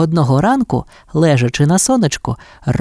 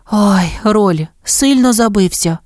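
higher, mildly anxious mother voice; worried concern; 6 semitones higher than usual. A narrator's reading. Mother dog rushing to fallen pup, worried. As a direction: ask after a hurt child, pitched up.

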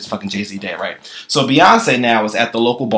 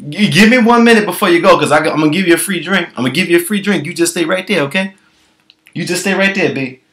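higher, sweet-talking bartender; 6 semitones higher than usual. Retold or imitated. Bartender stalling the drunk wife, higher. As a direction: keep her there, pitched up. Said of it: "So the bartender was like".

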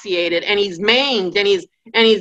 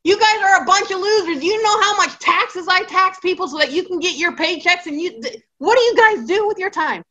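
high-pitched mock rant at his useless advisers; hammed-up exasperated king, much higher; frustrated Pharaoh; 9 semitones higher than usual. Preacher voicing Pharaoh berating his wise men, high exasperated rant. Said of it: "Pharaoh is frustrated, like".

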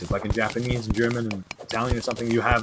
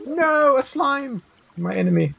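high, drawn-out wailing "no"; mock despair; 12 semitones higher, slower than usual. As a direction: cry out in hammed-up dismay.

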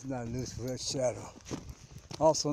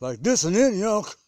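much higher and much louder, a put-on local voice; Antioch way of talking; 6 semitones higher, 12 dB louder than usual. Shown as a demonstration. Tour guide showing the viewers how Antioch people talk, put-on high loud voice. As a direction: pitch it way up and belt it out as an Antioch local. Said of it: "That's how they talk".

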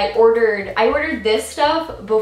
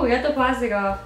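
ordinary talk

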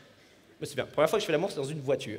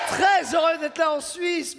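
grand greeting; 6 semitones higher, 3 dB louder, slower than usual. Acted. Comic opens set to Swiss crowd.

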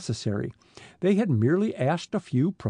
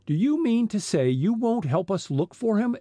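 higher, incredulous exclamation; exclaiming; 7 semitones higher than usual. A narrator's reading. Frank challenging the chief's refusal to help, exclaiming. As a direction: exclaim it in raised-pitch disbelief. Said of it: "exclaimed Frank".

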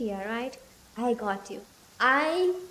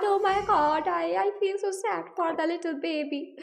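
pitying coo; 5 semitones higher than usual. Real, not acted. Streamer coos with pity over a sad cute pet. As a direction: melt over the little creature.

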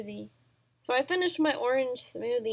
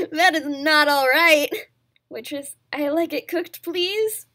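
loud, huffy complaining voice; mock indignant customer; 9 dB louder than usual. Customer complaining to the waitress about raw turkey, loud and huffy. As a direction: act offended that it's raw.

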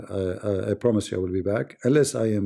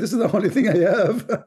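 laughing; 9 semitones higher than usual. Real, not acted. Laughs mid-sentence.

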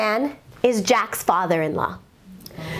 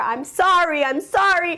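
crying apology; 6 semitones higher than usual. Retold or imitated. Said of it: "both of them are crying and hugging".

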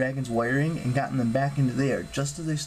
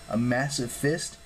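ordinary delivery, nothing marked

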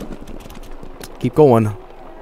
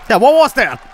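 surprised shout; 10 semitones higher, 4 dB louder than usual. Real, not acted. Surprised at the game event.

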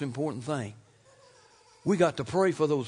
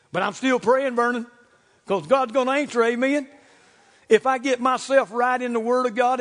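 loud, high, stretched pulpit cries; forceful preaching; 6 semitones higher, 7 dB louder than usual. Real, not acted. Preacher telling congregation of Jesus' prayer, forceful preaching.

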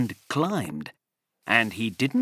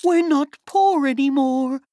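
overjoyed mother; 13 semitones higher, 6 dB louder than usual. A narrator's reading. Mother voiced in the story.

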